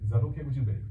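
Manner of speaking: calm him down, kindly asking for space; gentle husband. Retold or imitated. Husband tells his wife he needs time, gently.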